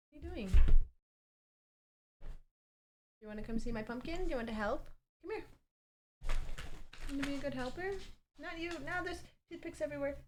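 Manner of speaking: gentle sing-song pet voice; pet talk. Real, not acted. Talking to her dog.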